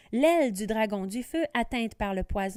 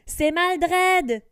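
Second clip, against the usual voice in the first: loud, high alarmed cry; exclaiming; 7 semitones higher, 8 dB louder than usual. Acted. Johan spotting the enemy wizard, loud exclamation. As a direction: blurt out the name in shock. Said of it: "s'écrie Johan".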